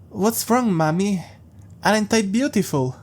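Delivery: put-on a small child proudly telling his mommy; childish creepy voice. Jeff asks his mother sweetly.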